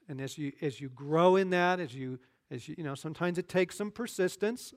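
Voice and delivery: ordinary delivery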